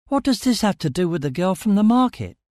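high-pitched puzzled demand; insistent questioning. A narrator's reading. Narrator presses Stellos about the girl, insistent.

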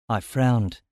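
ordinary delivery, nothing marked

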